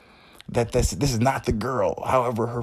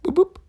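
phone beep; 7 dB louder than usual. Retold or imitated. Imitating text notification.

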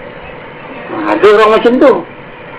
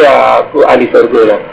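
low, slow, self-satisfied thought; musing to oneself, pitched well down; self-righteous musing; 12 semitones lower, slower than usual. Acted. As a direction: draw it out low, privately sure of yourself. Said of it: "Fikir-fikir".

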